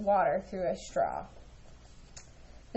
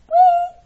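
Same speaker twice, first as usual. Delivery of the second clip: high loud bird "wee"; chirp; 15 dB louder than usual. A narrator's reading. Bird thanking Ava, high chirp. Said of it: "chirped Lucille".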